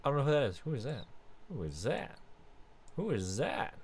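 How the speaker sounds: ordinary delivery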